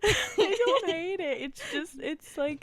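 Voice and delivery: laughing denial. Real, not acted. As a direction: deny it while laughing. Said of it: "she hates it".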